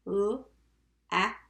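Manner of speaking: "l, ap" said sound by sound with gaps. Shown as a demonstration. Teacher tapping the first syllable, sounded out. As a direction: tap out "l, ap" in separate sounds. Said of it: "I'm gonna tap it with you".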